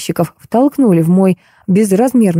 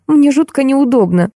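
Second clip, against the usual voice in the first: put-on high, fretful girlish voice; lamenting; 7 semitones higher than usual. A narrator's reading. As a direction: keep up a mild plaintive fuss. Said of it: "продолжала причитать девушка". (причитает высоким девичьим голосом)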